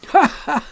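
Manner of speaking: high mock-hearty ha ha; a hammed-up shopkeeper's laugh; forced laugh. A narrator's reading. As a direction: dealer laughing off the customer's joke. Dealer laughs at joke.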